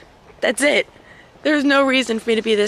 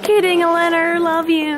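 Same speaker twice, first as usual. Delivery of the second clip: high playful; 9 semitones higher, 5 dB louder than usual. Real, not acted. Calling out to friend.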